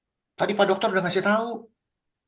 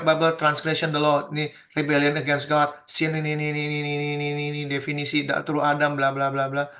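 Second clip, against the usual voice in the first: skim the text with filler syllables; skimming dismissively; 4 semitones lower than usual. Real, not acted. Skims through on-screen English definition. (membaca sambil lalu, pelan dan rendah)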